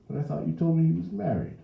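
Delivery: high, loud New Orleans counter voice; surprised gossiping saleslady; puzzled saleslady. A narrator's reading. Miss Inez questions the mother.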